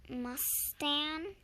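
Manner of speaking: plain reading voice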